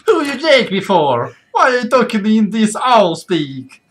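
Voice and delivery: high, loud mock-Italian owl; Italian accent. Game master playing an indignant owl, hammed-up Italian accent.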